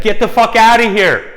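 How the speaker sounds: full-throated high yell imitating her; retold scream. Woman ordering her date out, furious scream.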